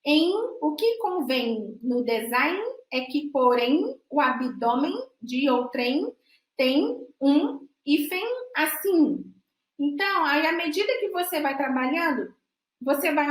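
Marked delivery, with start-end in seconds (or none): 0.0-9.2 slow, over-articulated tongue twister. Shown as a demonstration. Teacher modelling tongue-twister sentence, slow and precise. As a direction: spell out every nasal ending slowly. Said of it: "produzir todas as estratégias de ponto de articulação dentro de frase".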